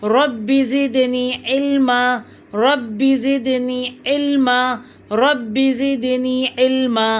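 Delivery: slow, drawn-out dua recitation; measured chant-like supplication; recited Arabic prayer. Real, not acted. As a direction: recite it slowly, holding the long vowels.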